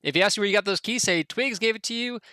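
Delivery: lightly voiced local passing the buck; Twigs, dismissive. Townsman brushing off the party about the keys, dismissive.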